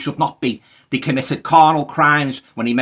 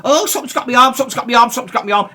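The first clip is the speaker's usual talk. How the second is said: high, loud hammed-up terror, easing off; a frightened ghost hunter yelping that something grabbed his arm; mock panic; 12 semitones higher, 6 dB louder, faster than usual. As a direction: squeal it like a scared goon. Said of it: "and he goes".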